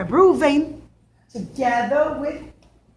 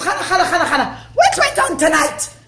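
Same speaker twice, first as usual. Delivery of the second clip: urgent calling; 4 semitones higher, 5 dB louder than usual. Acted. Father wakes daughter urgently.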